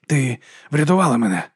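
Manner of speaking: said on an outgoing breath; mild breathed-out amazement at being saved; exhaled words. Acted. Monk to his rescuer, exhaled. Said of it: "видихнув він".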